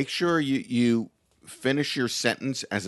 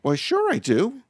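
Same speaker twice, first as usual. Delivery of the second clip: high, drawn-out playful reply; eager helper; 12 semitones higher, 4 dB louder, slower than usual. Pretend. Playing along with a request for directions, eager high reply. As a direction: sing out the answer, pitched well up. Answers in the role-played directions.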